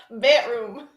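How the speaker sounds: Bedroom again with the d left out, said "pætrum". Shown as a demonstration. Guest repeating her one-word accent demo. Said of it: "'Cause I don't say the D's".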